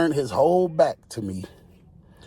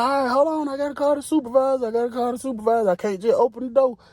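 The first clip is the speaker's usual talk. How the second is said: pitched way up for the officer; mock high woman's voice; 12 semitones higher, faster than usual. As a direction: play a casual female officer, high. Officer explains she must call.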